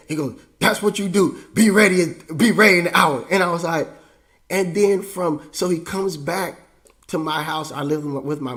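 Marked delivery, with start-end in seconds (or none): 0.6-3.3 loud, high, shouted command; stern dad's order. Retold or imitated. Dad on the phone ordering his son to be ready, shouting. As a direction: bellow it like an impatient father.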